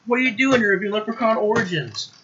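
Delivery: high, pleading voice of the alarm clock. Pretend. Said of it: "It's like".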